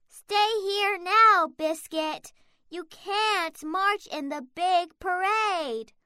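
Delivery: ordinary delivery, nothing marked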